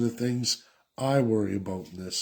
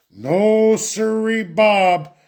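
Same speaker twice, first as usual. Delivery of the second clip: mock folksy; 7 semitones higher, 7 dB louder, much slower than usual.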